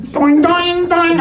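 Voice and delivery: imitating Indian hold music, high and loud; hammed-up hold music hum. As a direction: sing the hold tune in a mock high voice.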